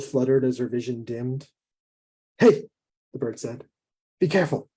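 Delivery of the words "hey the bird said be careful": sharp warning. Acted. Giant bird warning a woman about to faint, sharp. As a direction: cut in quickly with a warning. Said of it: "The bird's sharp words".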